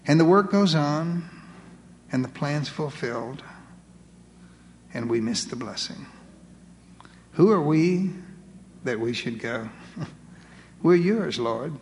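low, unhurried pastoral prayer; solemn appeal to God, sinking at phrase ends. Real, not acted. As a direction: pray in short phrases with long pauses. Leads closing prayer.

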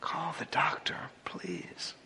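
whispered, hushed plea; calm plea. Acted. Pastor whispering to Bobby to call the doctor, hushed. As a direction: breathe the plea without voice. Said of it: "pleaded in an amazing calm voice".

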